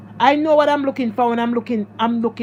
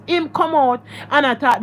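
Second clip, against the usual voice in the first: usual speaking voice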